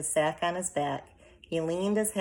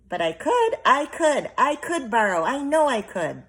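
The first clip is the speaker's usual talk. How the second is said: much higher and louder, pleading over and over; insistent pleading; 10 semitones higher, 9 dB louder than usual. A narrator's reading. Arrietty insisting to her mother, high and loud. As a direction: insist with everything you have.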